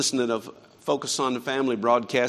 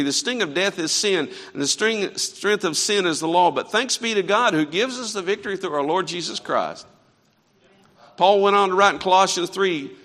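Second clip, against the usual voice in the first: louder, higher, ringing pulpit delivery; preaching at full force; 5 semitones higher, 6 dB louder than usual. Acted. Preacher proclaiming Paul's words to the church, louder and higher. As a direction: proclaim the scripture to the congregation.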